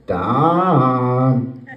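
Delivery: low, alarm-like inner voice calling. Retold or imitated. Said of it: "pipes in and goes".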